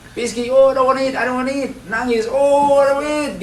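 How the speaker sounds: high whining child voice, a touch of fake crying; whiny child. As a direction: whine like a small boy who won't eat. Mother voices crying child refusing food. (anak merengek menolak makan)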